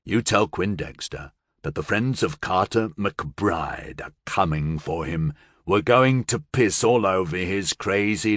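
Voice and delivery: low, slow, dark voice; possessed man to Billy Joe, slow and threatening; menacing possessed man. Acted. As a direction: spell out the threat coldly. Possessed man dictates his message.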